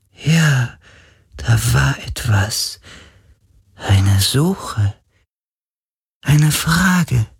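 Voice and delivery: high, breathy spirit voice; eager ancient spirit asking after old friends; ghostly spirit. A narrator's reading. Ghost asks Carlin about his father Vago, high spirit voice.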